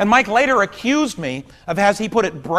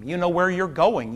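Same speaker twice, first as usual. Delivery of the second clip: usual speaking voice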